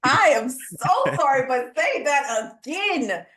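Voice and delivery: high, excited repeating back; excited, asking the host to repeat the point; enthusiastic. Real, not acted. Host repeating the guest's point back, excited and high. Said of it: "uh, enthusiasm".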